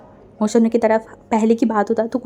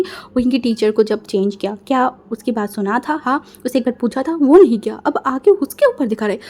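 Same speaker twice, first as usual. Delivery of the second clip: usual speaking voice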